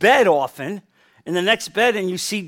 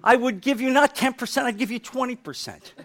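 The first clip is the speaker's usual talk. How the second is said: higher-pitched, mock-pleading prayer; imitated bargaining prayer; 5 semitones higher, faster than usual. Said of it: "there are people praying like this".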